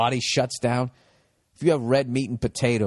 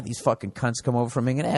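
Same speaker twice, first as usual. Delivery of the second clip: ordinary talk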